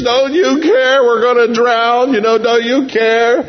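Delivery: mock panicked disciples; screaming to Jesus. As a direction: scream for help in the storm. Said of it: "they're screaming to Jesus".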